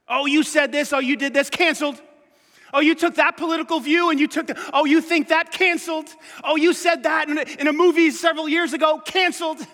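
high, loud mocking accuser voice. Mocking voice of people who cancel others. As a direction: sneer out each canceled.

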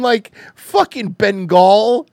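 usual speaking voice

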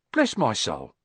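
plain reading voice

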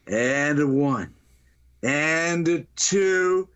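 very slow, stretched count; slow countdown. Retold or imitated. Paramedic counts down to the zipper pull, slow and suspenseful. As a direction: draw out each number before the pull.

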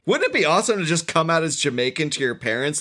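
normal talking voice

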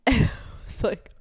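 sharp high gasp of shock; retold gasp, high and sudden. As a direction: gasp as if seeing two lines. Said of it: "my jaw dropped".

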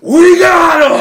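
high, full-volume victory yell; triumphant shout. Retold or imitated. Retelling the bin Laden news to the group, triumphant shout. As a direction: shout it like a huge win.

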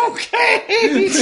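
very high laughing squeak; high squeaky okay. Acted. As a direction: squeak it out in disbelief. Clerk reply, high squeak.

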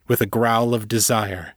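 ordinary delivery

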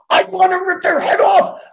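high, loud hammed-up yell with a laugh in it; exasperated mock outburst. Mock outburst at slow-talking amiable caller.

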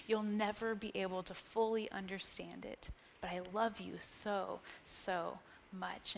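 soft, low, gentle assurance; tender reassurance. Acted. God telling us how much he loves us, softly tender. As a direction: voice a loving God, hushed and unhurried. Voicing God's tender answer.

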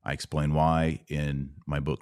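ordinary talk, nothing marked

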